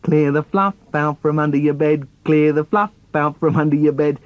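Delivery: self-important chanted order on a level pitch; pompous, monotone bossy brother; puffed-up brother. Acted. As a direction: puff yourself up and parrot the order. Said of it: "all puffed up".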